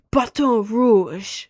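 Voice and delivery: slow, loud, fancy French Baton Rouge; French accent. Shown as a demonstration. Streamer to chat, saying Baton Rouge in a showy French accent. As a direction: say Baton Rouge like you're really French, savoring it. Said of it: "I like saying that".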